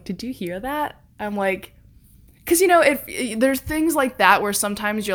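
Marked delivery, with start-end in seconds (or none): 0.0-1.0 quiet, hushed worried question. Retold or imitated. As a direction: ask it under your breath, uneasy. Mom whispers hearing a noise.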